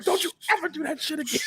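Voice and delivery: high, quick mock warning; retold scolding. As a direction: scold in a squeaky rush. Retelling warning his friend.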